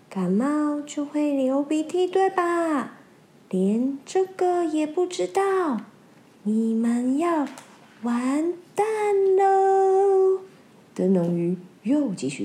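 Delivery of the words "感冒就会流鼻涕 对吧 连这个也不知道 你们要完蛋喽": slow, sneaky, drawn-out villain; sly lantern fish. Acted. Lantern fish spreading the rumour, slow sly voice. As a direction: savour the trick like a schemer.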